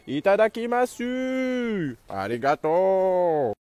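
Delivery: slow, hammed-up Japanese accent; mock Japanese accent. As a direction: stretch the Japanese words out slowly. Joking he is in Japan.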